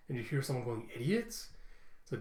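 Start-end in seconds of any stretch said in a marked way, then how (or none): 0.9-1.5 high rising query; questioning echo. Retold or imitated. Someone overhearing Law mention idiots, questioning echo. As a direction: echo the word in puzzlement.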